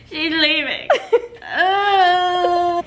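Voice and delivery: high, hammed-up sob with a laugh in it; fake-cry through a giggle; mock crying. Moving out, pretending to weep over it.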